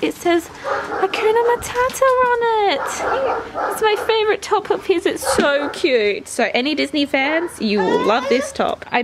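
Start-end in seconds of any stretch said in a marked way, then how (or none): 0.0-6.3 high, delighted gushing; gushing over cute top. Real, not acted. Mum gushing to her son about his top, high and delighted. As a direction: coo over the little shirt.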